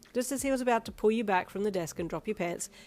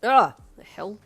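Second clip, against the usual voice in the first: loud disgusted yelp; 3 semitones higher, 14 dB louder, slower than usual. Acted. Snot on his chin.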